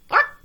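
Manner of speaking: a high yelped bark; mock bark. A narrator's reading. Hen barking a loud high bark. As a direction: bark it out like a silly animal.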